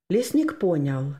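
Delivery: usual speaking voice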